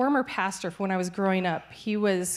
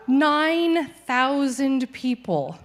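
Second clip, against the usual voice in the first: Nine thousand people, loud, higher and very slow; emphatic; 6 semitones higher, 6 dB louder, much slower than usual. Real, not acted. Telling supporters about the crusade, slow loud emphasis. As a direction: let Nine thousand people land with awe.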